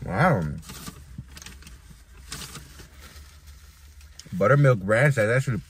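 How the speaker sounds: talking with mouth full; chewing through the words; messy loud eating. Real, not acted. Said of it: "Excuse me if I'm always talking with my mouth full".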